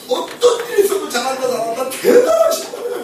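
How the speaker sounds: high, loud, hammed-up praise; lavish praise. Praising a dominant person no matter what, gushing and high.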